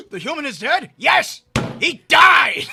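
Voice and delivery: gasp, then high shrieking shout; overplayed horror, yelling; shocked shouting. Acting shocked discovery.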